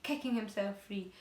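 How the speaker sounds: ordinary delivery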